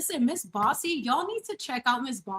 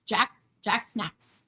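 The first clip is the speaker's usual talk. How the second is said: slow drawn-out name; much slower than usual. Shown as a demonstration. Greeting viewer Jack in chat, slow drawn-out name. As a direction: drawl the name out slowly. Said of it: "Hi, Jack".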